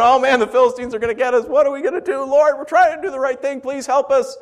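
hammed-up desperate cry for help; panicked plea. Israel's voiced panicked plea.

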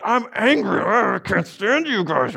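hammed-up angry growl, high and dragged out; mock angry. Preacher acting out his own anger to the congregation, mock angry. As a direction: play your own rage as a comic tantrum. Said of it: "when I'm angry like that, I'm like".

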